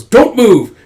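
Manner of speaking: loud, high barked order; hijacker's command. Acted. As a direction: shout it as a threatening gunman. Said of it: "And Ski Cap goes".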